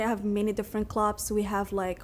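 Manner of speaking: ordinary delivery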